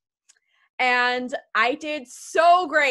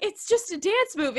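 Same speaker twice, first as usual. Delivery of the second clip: tearful protest; 10 semitones higher than usual. Retold or imitated. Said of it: "I'm crying".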